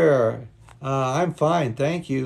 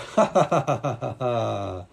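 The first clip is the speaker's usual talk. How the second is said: long ha-ha laugh, gradually falling; demonstrated laughter; 3 semitones lower than usual. Shown as a demonstration. Teacher illustrating the sound of laughter, demonstrated laughter. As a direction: show what laughter sounds like. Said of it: "The sound of laughter".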